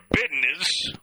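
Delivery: "business" with a d for the z, BID-ness; Southern "bidness". Shown as a demonstration. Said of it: "not business. Business".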